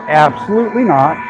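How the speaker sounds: normal talking voice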